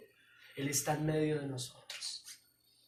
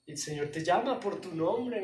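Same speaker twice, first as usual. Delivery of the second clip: ordinary talk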